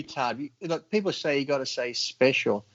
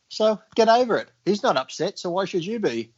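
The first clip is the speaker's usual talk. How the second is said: normal talking voice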